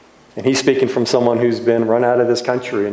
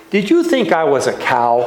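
higher-pitched toddler imitation; Ben, little child's voice; 4 semitones higher than usual. Toddler in the pastor's arms asking him, little voice. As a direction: ask it like a little kid. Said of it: "he'll say in that little voice".

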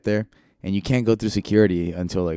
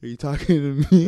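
higher, far from usual voice; mock confrontation; 7 semitones higher, 4 dB louder than usual. Ref challenging a competitor who spoke up, mock confrontation. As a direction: play at squaring up to someone.

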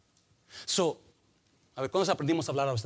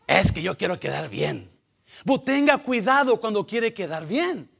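loud, raised preacher voice; forceful preaching; 7 semitones higher, 9 dB louder than usual. Real, not acted. Preacher warns against talking to look good, forceful preaching. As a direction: admonish them loudly from the pulpit.

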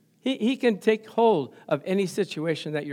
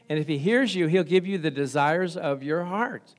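ordinary delivery

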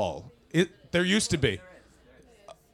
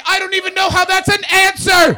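shout; 13 semitones higher, 12 dB louder than usual. Real, not acted. Shouted exasperation.

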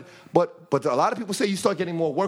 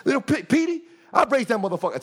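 lightly voiced veteran gangster bragging; old gangster boasting; 7 semitones higher than usual. Quoting the old gym gangster about Pistol Pete, mock proud boast.

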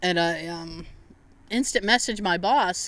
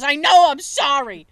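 loud, higher mock wail of apology; retold upset apology; 6 semitones higher, 7 dB louder than usual. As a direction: imitate a distraught friend apologizing. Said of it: "she was, like, crying".

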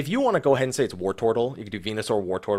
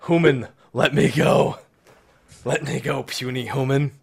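booming frost giant; 2 semitones higher than usual. Pretend. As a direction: voice the cat as a grand giant. Voicing the cat's demands.

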